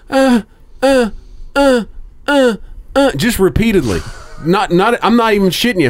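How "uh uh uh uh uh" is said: high-pitched rhythmic grunts, mimicking; repeated squeaky uh sounds, hammed-up; mock high grunting. Retelling overheard couple upstairs, high mock grunting. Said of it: "all you hear is".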